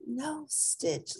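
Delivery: high, soft disappointed sigh; girl gently scolding her pet, soft and high. Acted. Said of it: "Lilo sighed".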